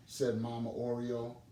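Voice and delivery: usual speaking voice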